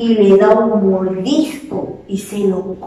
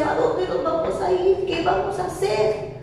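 scared; 7 semitones higher, 7 dB quieter than usual. Acted. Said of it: "estaban asustados porque decían".

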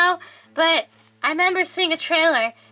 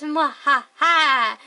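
mad scientist; much slower than usual. Pretend. Spooky episode evil laugh.

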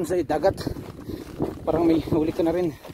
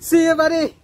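high, bright call to a friendly dog; 8 semitones higher, slower than usual. Real, not acted. Calling to a passing dog. (masayang pagtawag sa aso)

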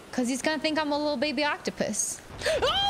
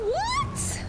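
shocked. Real, not acted. Shock at boss.

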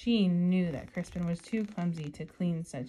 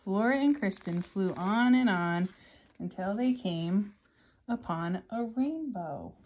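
story narration; 7 dB louder than usual. Acted. Reading picture book aloud.

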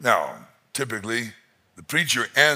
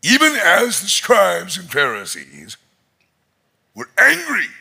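higher and louder, climbing to a cry on "angry"; rising preaching peak; 8 semitones higher, 6 dB louder than usual. Real, not acted. Preacher driving home the Pharisees' anger, rising loud. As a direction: build the line up to a near shout.

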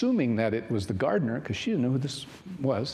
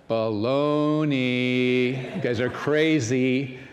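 slow, louder scornful baloney; scoffing Thomas; 5 dB louder, much slower than usual. Acted. Thomas dismisses the disciples' story, slow and scoffing. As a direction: drag out the scoff in disbelief. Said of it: "what Thomas said? Paraphrasing".

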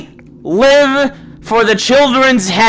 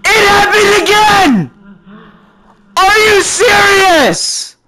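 outraged shouting; 8 semitones higher, slower than usual. Real, not acted. Player killed again, shouting in outraged disbelief. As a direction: yell in outrage at the unfair death.

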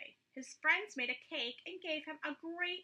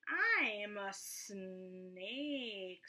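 snake voice; 3 semitones lower, much slower than usual. A narrator's reading. Snake drawling its answer to Roy, slow. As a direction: stretch every sound like a lazy snake.

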